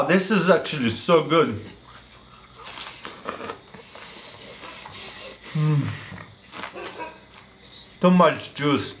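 words muffled by food; talking while chewing. Real, not acted. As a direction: mouth full of juicy fruit. Seen 2: Bites at -2; chews with food visible in mouth throughout.

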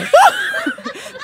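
evil witch cackle. Acted. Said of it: "Eu quero voz de demônio".

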